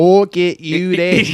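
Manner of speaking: slow, hammed-up Statham-style accent; badly faked Statham accent. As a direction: ham up a shaky attempt at Statham's accent. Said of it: "I don't know what accent. I'm not good at accents".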